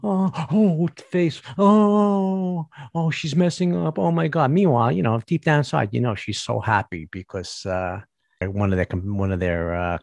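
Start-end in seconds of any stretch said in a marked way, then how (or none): 0.0-4.5 higher gushing fake worry; mock fake concern. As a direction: play the rival pretending to care. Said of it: "who's like".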